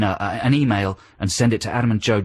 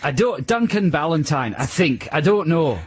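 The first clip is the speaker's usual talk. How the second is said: put-on Scottish accent, pitched up; Duncan Ballantyne; 4 semitones higher than usual. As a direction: answer in a mock Scottish brogue. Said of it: "Your Scottish accent".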